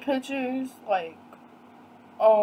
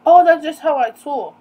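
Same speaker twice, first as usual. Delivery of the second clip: louder, higher mock retort; retold defensive retort; 5 semitones higher, 9 dB louder than usual. Man excuses his talk to her, retold defensively. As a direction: imitate him brushing it off, raised voice.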